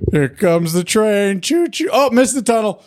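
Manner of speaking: slow, high sing-song feeding voice; airplane spoon game. Pretend. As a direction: coax a baby to eat.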